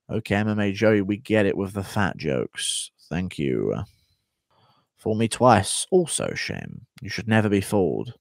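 ordinary talk, nothing marked

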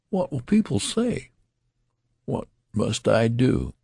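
high-pitched dismay at a scandal; horrified. A narrator's reading. Father dreading what people will say, horrified. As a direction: cry out in dismay, voice pitched well up. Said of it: "in an ecstasy of horror".

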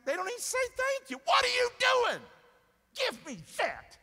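high-pitched mock outraged shout; exasperated outburst. Parent to ungrateful children who never say thank you, exasperated outburst.

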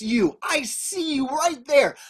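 ordinary delivery

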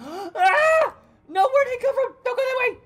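high, loud panicked shouting; panicking gamer. Real, not acted. Chased by big guy.

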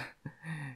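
a quiet breathy laugh; soft laugh. Real, not acted. Breaking off while reassuring him, quiet laugh.

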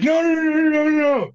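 very high, loud mock protest; hasty denial. Director denying he is hitting on kid, frantic. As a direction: squeal out a frantic string of denials.

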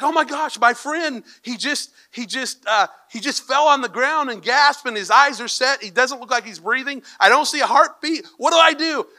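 high, frantic retold phone plea; hammed-up panic on an emergency call; mock panic. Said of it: "He's panicking, so he calls".

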